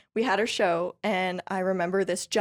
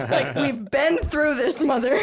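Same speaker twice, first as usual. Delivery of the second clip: raised-voice exasperation through laughter; half-laughing, higher, louder complaint; mock exasperation; 6 semitones higher, 6 dB louder than usual. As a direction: playfully protest to mom, drawing the words out. Mock protest to nudging mom.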